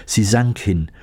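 usual speaking voice

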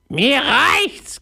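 very high parrot squawk; Paparazzi, squawking. Acted. Parrot refusing the pirate's plan, squawking. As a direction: croak it like a fed-up parrot. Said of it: "krächzte er".

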